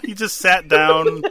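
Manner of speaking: giggling as she repeats the punchline; laughing retell. Real, not acted. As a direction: let the laugh take over, slowly. Retelling Eugene sitting down.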